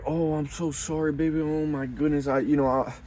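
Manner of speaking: lightly hammed-up sorry boyfriend, fast at the end; groveling boyfriend. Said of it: "If you're like".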